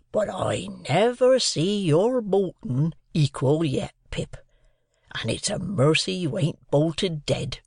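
slow, swooping country voice, gravely concerned; earnest rustic Joe. A narrator's reading. Joe confessing his own boyhood bolting, slow and earnest. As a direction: reason slowly with the boy in simple wonder. Joe's voice in story.

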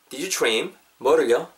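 ordinary delivery

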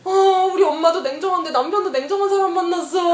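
high, loud tearful wail; mock sobbing complaint; retold bawling. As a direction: re-enact a self-pitying cry, played up. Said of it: "막 엉엉 울면서".

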